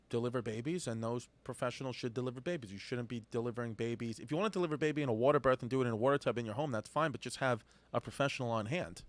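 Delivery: normal talking voice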